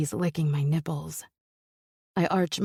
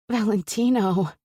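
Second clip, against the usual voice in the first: sharp, louder frustrated call; irritated growl; 4 semitones higher, 4 dB louder than usual. Acted. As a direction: snap his name in frustration. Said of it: "I growl in irritation".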